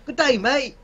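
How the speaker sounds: high-pitched mock Australian; Australian accent. Friend mocking an imagined Australian host, exaggerated Australian accent. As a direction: put on a bad Aussie accent. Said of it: "that was an awful accent".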